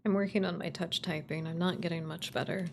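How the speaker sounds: ordinary talk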